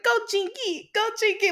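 high squealing cheer; excited cheering. Retold or imitated. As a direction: cheer him on like a little sister. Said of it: "she was like".

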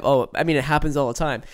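usual speaking voice

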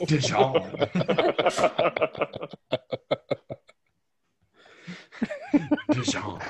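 says it loud through laughter; cracking up at the word Dijon; laughing loudly at the Dijon name. Real, not acted.

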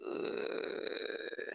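breathy unvoiced groan of disgust; disgusted ugh. Reacting to his posts with a voiceless ugh of disgust. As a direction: let out a voiceless ugh of distaste. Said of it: "I'd be like".

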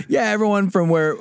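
high-pitched teacher quote; retold science teacher. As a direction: voice a teacher starting a generalisation. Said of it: "our science teacher, was like".

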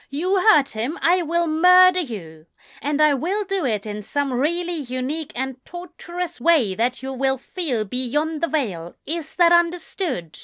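high, emphatic warning; stern threat. A narrator's reading. Protective sister warning her brother's boyfriend, stern threat.

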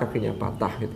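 normal talking voice

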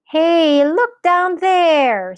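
put-on high, loud, drawn-out boyish shout; calling out. A narrator's reading. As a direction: call the family's attention, stretching it high. Boy calls out to family.

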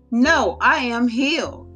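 ordinary delivery

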